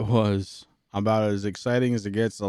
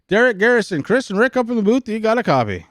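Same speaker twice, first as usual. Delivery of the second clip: calling out; 12 semitones higher, 6 dB louder, faster than usual. Real, not acted. Calling driver on radio.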